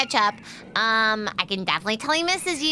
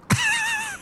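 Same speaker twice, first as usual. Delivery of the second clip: sudden high burst of laughter. Real, not acted. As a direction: cackle at your own joke. Laughs at own pun.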